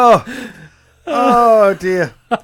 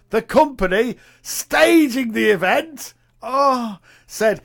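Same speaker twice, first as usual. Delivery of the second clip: ordinary delivery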